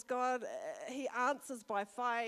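ordinary talk

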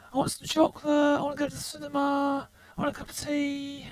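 put-on high-pitched little kid; child voice effect. Shown as a demonstration. Demonstrating the child preset by asking for treats, high child voice. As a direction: pipe up high like a small child. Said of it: "I got child".

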